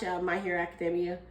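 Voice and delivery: ordinary talk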